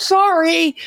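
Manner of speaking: mock yell from a swimmer in the race; retold yelled sorry. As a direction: call it out across the water, high. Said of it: "I would hear a woman yell out".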